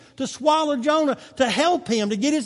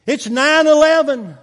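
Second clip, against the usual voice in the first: emphatic shout; 5 semitones higher, 7 dB louder than usual. Real, not acted. Preaching at full force.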